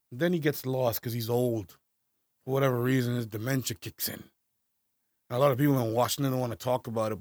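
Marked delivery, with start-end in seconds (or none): none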